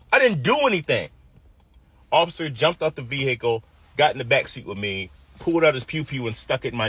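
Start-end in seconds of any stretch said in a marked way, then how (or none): 0.1-1.1 high-pitched frustrated yell at the cop; retold yell. Young man shouting his innocence at the arresting cop, high frightened yell. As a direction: yell it out, scared and mad. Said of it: "I was mad, I was scared, I was frustrated, and I yelled".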